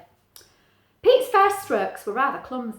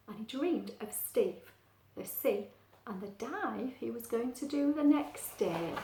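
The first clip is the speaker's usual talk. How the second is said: usual speaking voice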